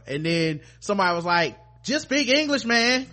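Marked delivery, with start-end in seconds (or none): 1.8-3.1 high, loud, fed-up plea; exasperated outburst. Retold or imitated. Crew member losing patience with the weird talkers, loud and exasperated. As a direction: beg them to just talk normally, pitched way up.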